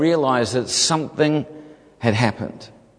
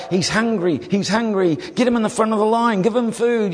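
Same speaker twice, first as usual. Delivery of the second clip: imitating excited word passed along, rising higher; mock-urgent, higher-pitched relay; urgent crowd chatter; 7 semitones higher than usual. Voices word spreading through the crowd.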